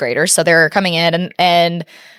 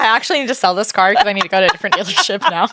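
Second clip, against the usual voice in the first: joking quote; 2 semitones higher, faster than usual. Retold or imitated. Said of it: "You're like".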